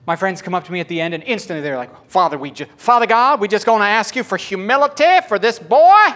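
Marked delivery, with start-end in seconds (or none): 2.1-6.1 loud preacher-style prayer over him; loud mock intercession. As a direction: pray loudly and laughing.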